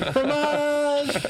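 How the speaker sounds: high, slow, savoured fromage; delighted mock French. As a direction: roll out fromage like a French chef. Savouring the word.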